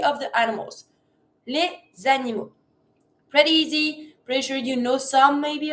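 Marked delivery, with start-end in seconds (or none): none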